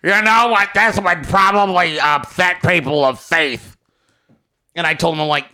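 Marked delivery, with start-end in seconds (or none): 0.0-3.8 mocking whiny fan. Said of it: "Some asshole with thirty followers like".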